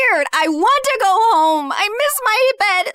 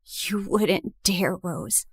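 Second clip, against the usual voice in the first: lower, much softer, slow and cold; cold low dare; 11 semitones lower, 9 dB quieter, slower than usual. Acted. Jessica dares Rose to condemn her, low and cold.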